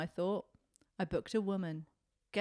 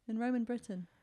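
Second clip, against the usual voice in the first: usual speaking voice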